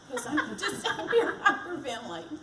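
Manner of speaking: breathless nervous laugh; laughing while out of breath. Real, not acted. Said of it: "'cause I'm just about out of breath".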